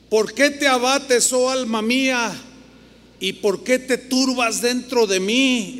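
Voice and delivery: solemn scripture voice; dramatic scripture reading. Acted. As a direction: read it slowly as a cry of the soul. Preacher reads psalm dramatically.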